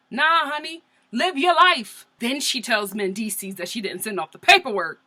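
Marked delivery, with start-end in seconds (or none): 0.1-2.0 higher and louder, emphatic; sassy. Real, not acted. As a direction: tell her to let it go with attitude. Sassy advice to stepmom.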